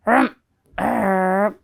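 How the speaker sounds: mumbling grumble; bear mumble. Acted. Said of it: "mumbled and grumbled".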